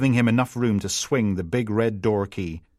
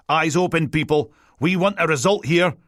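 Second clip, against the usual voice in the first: high, loud, rousing order; rally the team in a raised, urgent voice; commander over radio; 7 semitones higher, 5 dB louder than usual. A narrator's reading. Superior to the team over the airwave, loud urgent orders. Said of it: "Rutherford's voice fizzed through the airwave".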